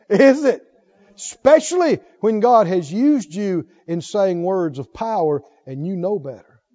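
normal talking voice

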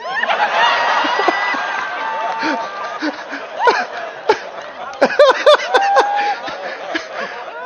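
long, high cackling laugh; drawn-out high laughing fit; imitated cackle. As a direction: cackle on and on, helpless with amusement. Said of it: "Just cackled, just laughing".

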